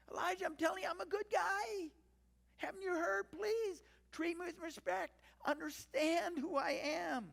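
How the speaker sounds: very high, tearful mock begging; hammed-up desperate plea in a high voice; pleading. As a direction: beg to be respected, slowing toward the end. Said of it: "like, pleading with Elijah".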